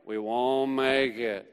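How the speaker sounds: slow, low, glum donkey voice; Eeyore. Retold or imitated. Preacher likens cynical Nathanael to a gloomy donkey, mopey. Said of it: "Nathanael is Eeyore".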